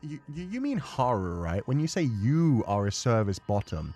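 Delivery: normal talking voice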